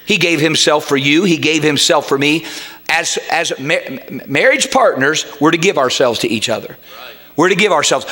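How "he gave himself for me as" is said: ordinary talk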